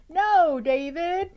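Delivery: high, loud stern mother calling out; scolding mom. Acted. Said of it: "David's mom always said".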